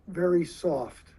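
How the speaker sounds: ordinary talk, nothing marked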